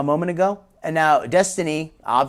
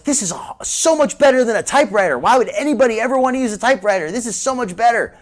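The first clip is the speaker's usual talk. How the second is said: higher, louder, emphatic amazement; excited retelling; 6 semitones higher, 5 dB louder than usual. Retold or imitated. Commentator recalling discovering computers as a child, excited. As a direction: relive a kid's amazement with raised pitch.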